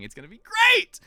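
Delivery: high, loud excited burst; hyped-up excitement. Real, not acted. Streamer hyping new monitor setup to viewers, excited. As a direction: shoot up high and loud on great.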